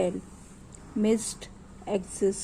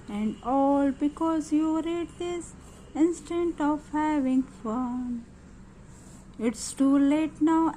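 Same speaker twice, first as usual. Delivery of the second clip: reading a rhyming poem aloud line by line for pupils; teacher modelling a children's poem to copy; poem recitation; 4 semitones higher, much slower than usual. Shown as a demonstration. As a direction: recite each line slowly, then say it once more for the class.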